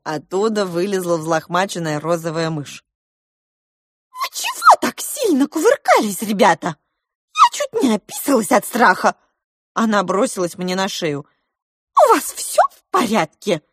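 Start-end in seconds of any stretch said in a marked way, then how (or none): 4.1-9.2 very high, loud squeaky mouse; tiny mouse squealing after a fright; excitable pink mouse, frightened. A narrator's reading. 12.0-13.6 very high, loud squeaky mouse; tiny mouse squealing after a fright; excitable pink mouse, frightened. A narrator's reading.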